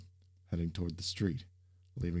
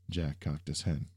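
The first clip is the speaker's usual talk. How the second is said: plain reading voice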